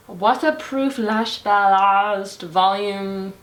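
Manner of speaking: slow, drawn-out mock French accent on the product name; accidental French. Vlogger reads the mascara name in an accidental French accent. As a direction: roll the name out like a French perfume ad, slowly. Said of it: "I don't know why it sounded French".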